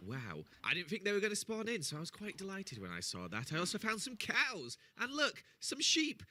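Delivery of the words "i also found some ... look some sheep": excited. Real, not acted. Showing off a find excitedly.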